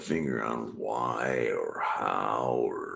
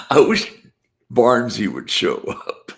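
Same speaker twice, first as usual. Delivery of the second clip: much higher, louder wishing; excited wish; 8 semitones higher, 9 dB louder than usual. Real, not acted. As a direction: call out hopefully for your friend. Wishing aloud.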